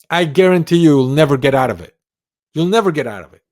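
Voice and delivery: loud, high, certain declaration. Shown as a demonstration. Coach showing viewers how to speak, loud and certain. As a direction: hammer it home with full certainty. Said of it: "you gotta speak loud".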